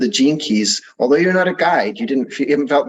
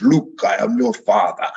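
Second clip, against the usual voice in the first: a light hint of a booming villain, barely changed from his own voice; just nod at the big movie line; Darth Vader. Host quoting the famous Star Wars line, lightly voiced villain. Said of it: "With a big pounding voice".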